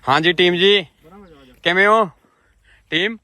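high, loud, playful hail to the team; dramatic loud aunty. Real, not acted. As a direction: call out to them brightly. Greeting the team loudly. (ਉੱਚੀ ਨਾਟਕੀ ਆਵਾਜ਼ ਵਿੱਚ team ਨੂੰ ਬੁਲਾਉਣਾ)

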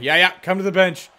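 lightly voiced teasing call; mocking opponents. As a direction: tease them off to the bench. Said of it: "and they're like".

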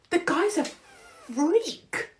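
squeaky high and very slow disbelief; incredulous. Real, not acted. Calling Starmer a freak to viewers, high incredulous drawl. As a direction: drag it out, high and appalled.

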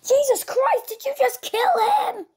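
panicked scream at a sudden murder; shocked shriek. Acted. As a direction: shriek in horror at the killing. Witness to a killing.

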